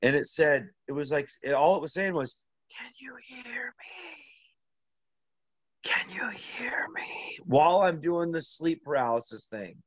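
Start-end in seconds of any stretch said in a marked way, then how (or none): 2.8-7.4 high breathy ghostly whisper, slow; slow eerie whisper of a phantom voice. Retold or imitated. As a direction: whisper it like a voice in your ear. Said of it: "I heard a voice in my ear".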